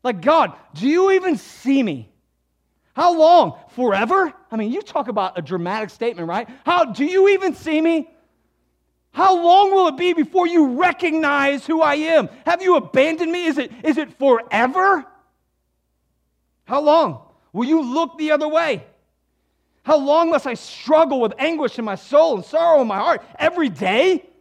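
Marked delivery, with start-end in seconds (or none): 0.0-4.3 loud, higher cry of a man abandoned by God; voiced psalmist crying out; anguished lament. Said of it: "David is saying". 6.6-24.3 loud, higher cry of a man abandoned by God; voiced psalmist crying out; anguished lament. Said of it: "David is saying".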